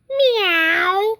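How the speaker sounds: high little meow; kitty meow. Pretend. Reader voicing the cats for kids, high cute meow. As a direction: mew it like a tiny kitten. Animal sounds in picture book.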